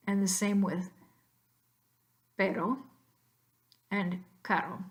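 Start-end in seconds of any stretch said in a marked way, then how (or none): 2.4-4.8 pero and caro said like pedo and cado, a quick D tap. Shown as a demonstration. Teacher shows students pero and caro with a D-like tap. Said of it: "better to say pedo and cado".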